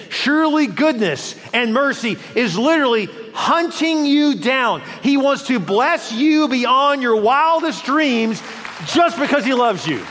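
high, emphatic preacher's climax; impassioned preaching. Real, not acted. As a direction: drive it home to the congregation. Preaching at full force.

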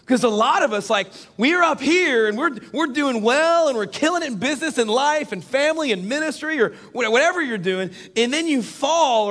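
high, driving preacher's delivery; forceful preaching. Acted. Preacher describing life up high before the fall, forceful preaching.

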